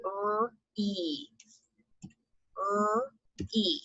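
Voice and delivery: quietly spelling letters for the class; soft letter spelling. Shown as a demonstration. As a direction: spell R-E gently, fading. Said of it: "so re is R-E".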